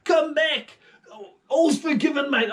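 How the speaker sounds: high loud casual king's call; Saul calling out. Acted. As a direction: shout it after him like an old mate. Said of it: "And he said".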